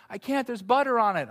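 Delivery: lightly voiced little boy excuse; little kid voice. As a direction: complain like a stuck little kid. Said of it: "little kid language".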